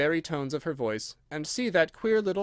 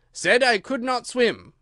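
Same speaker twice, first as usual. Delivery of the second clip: slow, higher, louder king reading; 8 semitones higher, 7 dB louder, slower than usual. Acted. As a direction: read the line slowly like the king. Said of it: "muttering over the verses".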